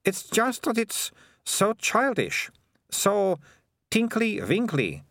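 high, slow, drawn-out disdain; Melissa, scornful girl. A narrator's reading. Melissa explaining herself to Keith, scornful. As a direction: stretch each scornful word in a high voice.

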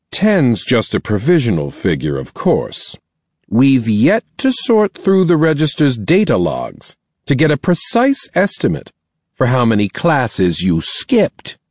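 a headmistress weighing her words; stern principal voice. Acted. Principal explains to parents.

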